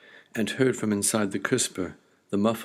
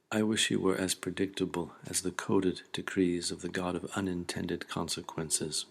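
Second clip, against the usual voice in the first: ordinary talk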